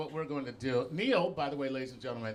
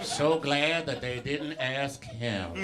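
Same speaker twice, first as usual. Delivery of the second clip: Morgan Freeman impression; 9 dB louder, much slower than usual. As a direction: drawl it out, chuckling. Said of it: "Morgan Freeman right now is like".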